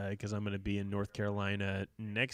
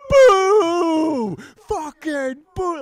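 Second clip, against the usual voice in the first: put-on huge drawn-out boo in a Philly accent; 17 dB louder, much slower than usual. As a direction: bellow it high like a Philly heckler. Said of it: "got that Philly accent".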